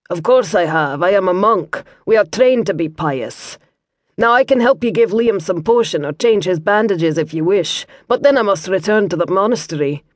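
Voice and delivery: light Scottish burr, calm and kindly; Scottish monk. Acted. Brother Matthew explains to Liam in a mild Scottish accent. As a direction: explain gently as a Scots monk.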